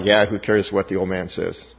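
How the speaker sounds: low, lightly voiced teen shrug; dismissive teenager. Teenager answering a friend about dad's curfew, lightly dismissive. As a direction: brush it off like a teenager.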